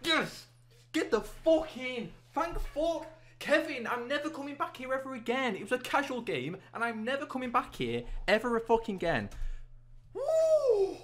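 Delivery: very high screaming joy, half laughing; ecstatic celebration. Real, not acted. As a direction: scream with relief and triumph. Celebrating a won game.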